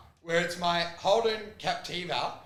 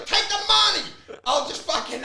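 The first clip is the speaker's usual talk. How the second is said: mock outburst; 9 semitones higher, 6 dB louder than usual. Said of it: "I'm like".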